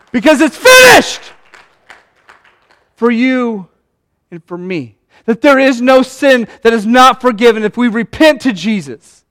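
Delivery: high, loud rousing sermon voice; full-force preaching. Acted. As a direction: lift the room, preach it with fire.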